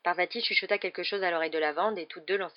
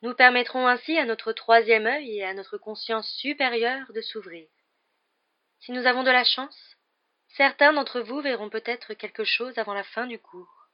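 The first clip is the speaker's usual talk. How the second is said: higher, louder, slow ethereal pronouncement; Trelawney's mystic voice; 5 semitones higher, 6 dB louder, slower than usual. A narrator's reading. Professor Trelawney lecturing her divination class, in a high, slow mystic voice. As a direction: intone it like a dreamy seer.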